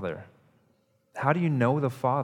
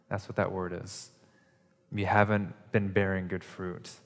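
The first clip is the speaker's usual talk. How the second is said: ordinary talk, nothing marked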